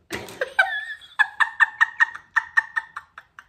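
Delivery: very high peals of laughter; squeaky, high fit of giggles; cracking up. Real, not acted. Laughing at her failed accent, cracking up. Cracks up after Cockney attempt.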